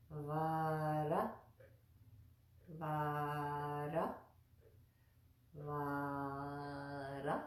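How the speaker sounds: "vara" drawn out slowly, r tapped on the roof of the mouth; tongue-on-palate r. Shown as a demonstration. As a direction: stretch the first vowel of "vara", then place the r. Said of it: "Placing that tongue on the".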